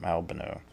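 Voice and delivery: Albano said "AL-buh-no", stress at the front; first-syllable stress. Shown as a demonstration. Tarot reader settles on one pronunciation, Albano stressed on AL. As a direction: say Albano with stress on AL and a reduced middle. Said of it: "I'll stick with one of 'em".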